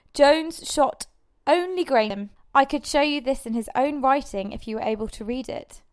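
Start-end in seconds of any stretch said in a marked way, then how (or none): none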